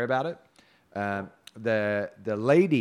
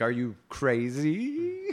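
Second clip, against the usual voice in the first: mock maiden question; 4 semitones higher, much slower than usual. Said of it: "You know, like".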